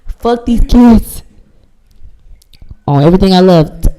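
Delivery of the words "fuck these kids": higher, louder and slow, dragging every word; slow emphatic yell. Retold or imitated. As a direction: stretch it out loud like a vow. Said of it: "I'm not capping".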